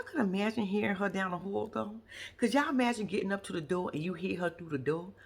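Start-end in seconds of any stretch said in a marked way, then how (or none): none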